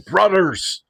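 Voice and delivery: high-pitched imitation of a friend's accented call; Igor's rallying cry. Igor stands up to address the group, imitated accented rallying cry. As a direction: mimic a man rallying his brothers, pitch soaring. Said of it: "in his very slight accent".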